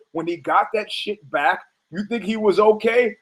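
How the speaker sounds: ordinary delivery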